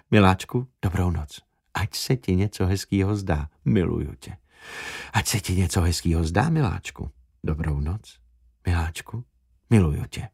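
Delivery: soft hushed goodnight into the mic; whispering. Acted. Recording a bedtime goodnight into a microphone, whispered. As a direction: whisper it close, like lulling someone to sleep. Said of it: "A šeptal do mikrofonu".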